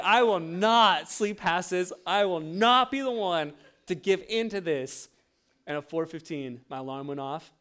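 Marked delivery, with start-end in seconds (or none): 0.0-5.1 louder, slow stubborn vow; mock determined vow. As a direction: swear it with proud resolve. Retold vow of stubborn pride.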